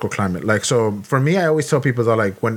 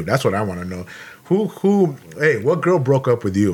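ordinary delivery

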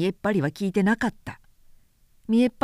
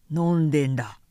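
put-on lower, glum drunkard mumble; dejected; 5 semitones lower than usual. A narrator's reading. Drunkard answering the prince, lower dejected voice. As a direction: answer with a sunken heart. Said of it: "しょんぼりと答えた".

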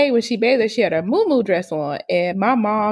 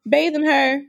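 usual speaking voice

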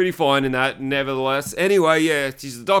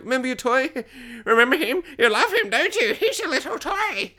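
very high cooing mum voice; mock baby talk; 10 semitones higher than usual. Mum showing teenage son his toy, mock baby talk. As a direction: coo it like to a toddler, with a laugh.

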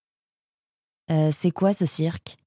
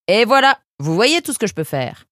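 triumphant; 5 semitones higher than usual. A narrator's reading. Apprentice boasting to the doctor after sweeping, triumphant. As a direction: show off the finished sweeping with pride. Proud air of victory.

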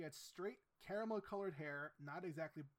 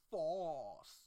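mock pretentious; 5 dB louder than usual. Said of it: "the most pretentious last name ever".

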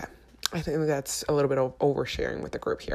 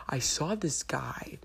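slow conspiratorial whisper; 6 dB quieter, much slower than usual. Acted. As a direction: whisper it slowly like juicy gossip. Secretive gossip about a guy.

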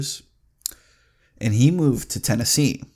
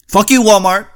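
loud, higher parting curse; angry shout; 8 semitones higher, 8 dB louder than usual. Real, not acted. Vlogger cursing Walmart at the end of his rant, angry shout. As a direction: spit it out one last time.

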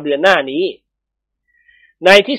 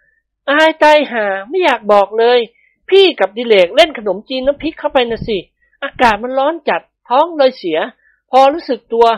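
higher-pitched, animated male character; embarrassed husband; 7 semitones higher than usual. A narrator's reading. Husband admits his messy accident to his wife, embarrassed. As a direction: confess it in a raised, sheepish voice.

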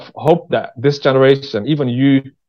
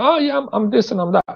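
high mocking voice of a fake podcaster; mocking fake bragging; 10 semitones higher than usual. Mimicking fake podcast guests, a high mocking sneer. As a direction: pitch it up high, sneering at the show-offs. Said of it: "they're like".

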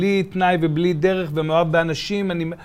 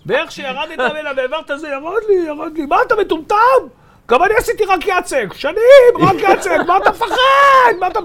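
much higher and louder, a hammed-up furious yell; imitating angry yell; 13 semitones higher, 9 dB louder than usual. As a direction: scold him like the tired mentor losing patience. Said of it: "והתחיל לצעוק עליי בעיניים אדומות". (חיקוי צעקה כועסת, גבוה וחזק)

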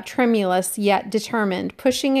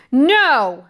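high, loud furious cry; enraged cry; 4 semitones higher, 7 dB louder than usual. Acted. Jack, in tears, cries out at Ralph, enraged and loud. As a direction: fling it back in rage. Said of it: "cried out, high-pitched, enraged".

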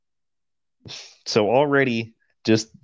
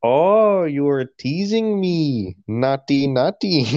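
put-on Palestinian accent; 5 semitones higher, slower than usual. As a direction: ham up a Palestinian accent, teasing. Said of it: "it cut to a dude like".

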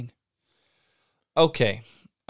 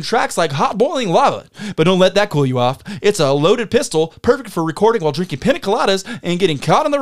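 big, bright prize-reveal announcer voice, raised pitch; game show announcer; 6 semitones higher, 7 dB louder than usual. Acted. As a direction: sell each prize like a TV host.

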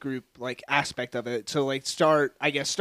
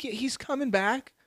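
high, loud, breezy dad voice; deadbeat dad excuse; 10 semitones higher, 11 dB louder than usual. Pretend. Mocking an absent father's excuses, high and loud. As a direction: fob them off with cheery lies. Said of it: "the standard American father where they're like".

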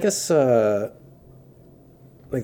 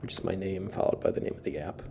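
late night radio voice; 8 dB quieter than usual. Pretend. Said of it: "I like this sexy voice you got going on".

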